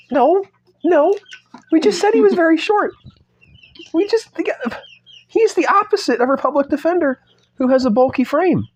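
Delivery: very high, squeaky protest; high-pitched reedy voice. Pretend. Objecting to the author about the bulky frame, high-pitched and reedy. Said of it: "give him a very high-pitched, reedy voice".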